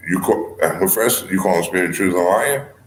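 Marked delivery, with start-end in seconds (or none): none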